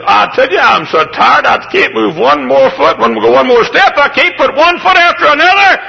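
high, loud worn-out whine, preached out; weary complainer. Pretend. Preacher voicing a tired mother, loud mock complaint. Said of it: "when you say".

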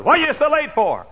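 loud, high scolding shout; scolding mother. Retold or imitated. Mother scolding her late son, loud angry shout. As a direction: demand an answer like an angry mother.

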